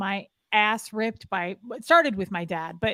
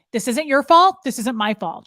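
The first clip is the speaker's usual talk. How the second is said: louder, clipped-down orders to an underling; harsh drill command; 4 semitones higher, 7 dB louder than usual. Retold or imitated. Mother scolding the young party worker, loud commanding tone. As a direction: lay down the law to the girl. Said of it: "She was like".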